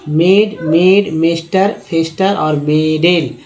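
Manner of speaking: मेड, मेस्टर, फेस्टर, मेडेल, all with long "ay"; A read as "ay". Shown as a demonstration. Teacher replays wrong readings for students, "ay" in each word. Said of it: "ऐसा नहीं होता है ना".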